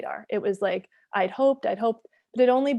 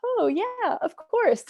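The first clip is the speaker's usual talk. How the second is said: mock eager agreement; 12 semitones higher, much slower than usual. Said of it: "I'm like".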